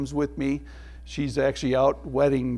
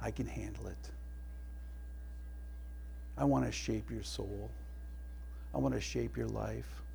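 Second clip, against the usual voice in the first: softer, hushed loving invitation; tender voice of the Father; 3 semitones lower, 13 dB quieter than usual. Acted. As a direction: speak as a gentle father calling softly. Voicing God the Father.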